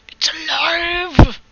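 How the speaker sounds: high, loud, drawn-out hammy cry; mock mad-scientist cry. Artist livening up textures for viewers, hammy mad-scientist cry. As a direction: stretch it out in over-the-top triumph.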